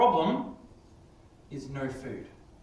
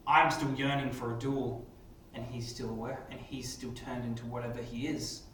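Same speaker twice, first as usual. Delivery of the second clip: story narration; 3 semitones lower, 4 dB quieter than usual. Acted. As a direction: narrate the story to the class with suspense. Teacher narrates model story.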